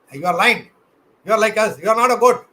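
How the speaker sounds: loud, firm scolding; forceful lion. Acted. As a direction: tell the cub off with force. Big lion confronting the cub.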